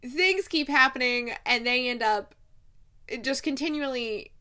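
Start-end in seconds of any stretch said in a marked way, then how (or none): none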